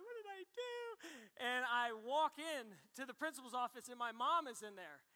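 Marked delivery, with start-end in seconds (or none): 0.0-0.7 high, alarmed little question; panicked kid. Retold or imitated. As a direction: squeak it out like a scared kid. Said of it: "I'm like".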